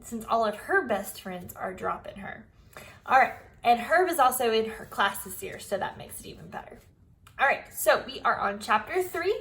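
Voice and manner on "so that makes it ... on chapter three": plain reading voice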